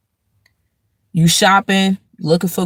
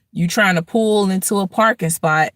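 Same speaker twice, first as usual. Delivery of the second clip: ordinary talk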